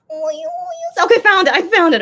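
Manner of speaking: high, loud burst of relief; relieved excitement. Real, not acted. Fan finally finds the video, excited and relieved. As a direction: cheer at finding it.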